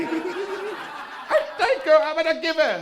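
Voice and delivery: high, very slow and loud; drawn-out high thanks; grateful stranger. Retold or imitated. The man thanking comic for directions, high slow voice. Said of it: "So finally I just said".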